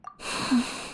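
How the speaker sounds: soft, airy exhale; long quiet breath out; dreamy sigh. Acted. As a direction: sigh softly to steady yourself. Overwhelmed, feels like a dream.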